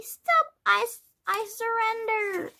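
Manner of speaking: high, loud pleading to give up; begging surrender. A narrator's reading. Boy pelted with snowballs, high loud surrender. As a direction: beg for mercy, out of breath. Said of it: "begged Henry".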